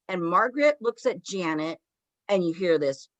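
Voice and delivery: usual speaking voice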